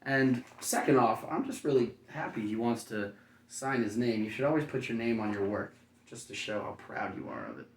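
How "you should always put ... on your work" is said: ordinary delivery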